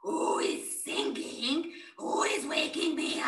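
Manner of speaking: a grumpy woken lion; angry lion. Acted.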